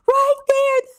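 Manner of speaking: very high retold squeal, voice cracking; excited screech, cracking. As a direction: shriek it till the voice breaks. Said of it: "my voice keeps cracking".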